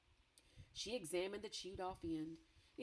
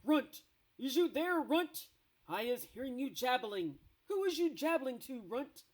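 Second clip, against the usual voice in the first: high, loud giant bellowing from outside; thundering giant shout; 6 semitones higher, 8 dB louder than usual. Acted. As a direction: roar it in through the cave door. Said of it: "a voice like thunder shouted".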